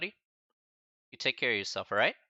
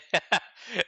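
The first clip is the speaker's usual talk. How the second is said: bursting, high-pitched giggle; high laugh; 10 semitones higher, 7 dB louder than usual. Real, not acted. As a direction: crack up loudly at the email. Amused reading student's email.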